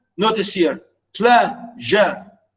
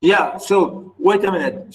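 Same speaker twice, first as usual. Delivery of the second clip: ordinary delivery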